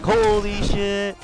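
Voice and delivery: stunned excited cheer; amazed. Retold or imitated. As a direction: draw it out in amazement. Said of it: "And we're like".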